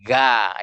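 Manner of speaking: GA with a thin, open A; light GA, tongue lying low; tipis. Shown as a demonstration. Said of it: "itu tipis". (GA dibaca tipis, lidah turun)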